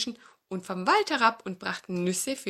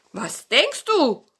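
slow, higher, louder; teasing old dog; 7 semitones higher, 9 dB louder, slower than usual. Acted. Old dog teases the fox, slow sly question.

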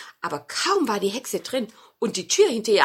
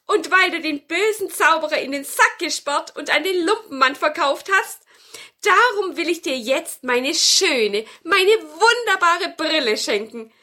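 good witch, overjoyed; 6 semitones higher, 6 dB louder than usual. Acted. Happy witch rewards grandmother with her glasses, high joyful voice. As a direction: gush gratefully in a raised witch voice.

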